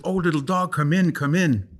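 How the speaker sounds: put-on a light, sweet girl's voice calling the dog in; coaxing girl. Girl coaxing a stray dog to come in, sweetly. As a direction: gently coax the little dog inside.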